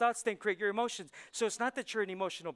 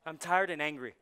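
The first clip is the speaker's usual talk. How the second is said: ordinary talk, nothing marked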